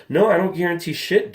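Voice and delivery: snapping back at a nagging buyer; riled up. Real, not acted. Vlogger snapping at buyers who want guarantees, riled up. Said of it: "Sorry, I'm getting riled up".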